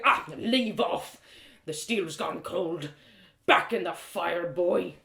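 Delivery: slow, rough smith grumbling an order; a tired master sending the lad back, slowly; gruff blacksmith, weary. Acted.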